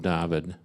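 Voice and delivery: "David" with "ah" in place of "ay"; Hebrew pronunciation. Shown as a demonstration. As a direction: pronounce "David" as DAH-vid, as in Hebrew. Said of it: "as it would be pronounced in, in Hebrew".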